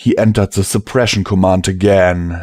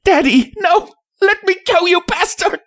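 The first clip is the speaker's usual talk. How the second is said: put-on very high, frantic child's protest; desperate little-girl shriek at contained volume; crying out; faster than usual. A narrator's reading. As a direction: struggle and wail as a filly being dragged away. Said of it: "tearing at me with her cries".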